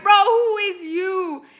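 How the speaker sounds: high, slow, drawn-out mock disbelief; baffled. Phoning back a stranger, asking in high slow disbelief. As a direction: stretch it out like you can't believe it. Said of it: "And I'm like".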